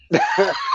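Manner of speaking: a sudden high laugh; short high laugh; burst of laughter. Real, not acted. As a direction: laugh out at the cheeky reply. Laughing at the kids' reply.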